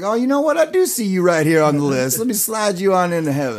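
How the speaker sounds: bright idea struck mid-list, voice lifted; welcoming into heaven. Retold or imitated. Gatekeeper letting someone into heaven, eager retold welcome.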